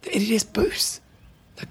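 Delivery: hushed aside; whispering. Real, not acted. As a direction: say it under your breath. Unvoiced whisper on tape.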